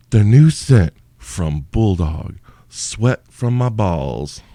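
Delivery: slow, drawn-out perfume commercial read; mock ad announcer. Host pitching his joke candle as a mock slow perfume ad. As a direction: sell it like a luxury fragrance.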